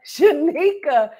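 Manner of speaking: Shanika with a laugh, slow; laughing correction. Shown as a demonstration. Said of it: "not Shinika".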